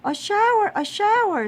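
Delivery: high, loud gleeful scream; children screaming. A narrator's reading. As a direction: squeal it like delighted kids. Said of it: "screamed Missus Ralph's four children".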